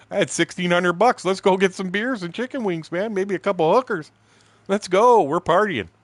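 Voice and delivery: high, loud, whooping party call; rally a friend to go partying, acting it out; elated celebration. Kerry finding out he owes nothing, loud mock elation. Kerry rejoicing at money saved.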